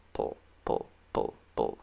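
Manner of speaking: "pull" whispered with no voice, close to the mic; whispered ending sound. Shown as a demonstration. Teacher isolating the ending, whispering "pull, pull". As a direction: breathe out "pull, pull" softly to show the mouth.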